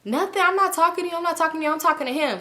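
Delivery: sassy, high-voiced denial; imitated snippy retort. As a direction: brush them off, imitating a snippy friend. Acting out her snippy retort.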